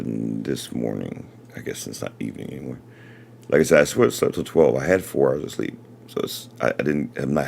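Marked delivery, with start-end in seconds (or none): 0.0-2.8 soft, hushed late-night voice; quiet at night. Real, not acted. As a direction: keep it low, someone sleeps. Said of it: "talking too softly".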